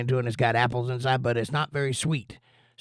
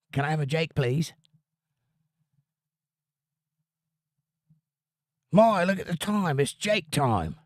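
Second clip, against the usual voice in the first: higher-pitched playful British voice; mock British accent; 5 semitones higher than usual. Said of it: "I had that accent".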